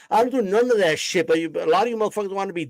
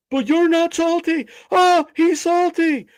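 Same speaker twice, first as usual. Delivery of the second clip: high, mocking sing-song taunt, much higher and louder; 8 semitones higher, 4 dB louder, slower than usual. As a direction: tease like a chat troll. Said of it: "we're not gonna do that".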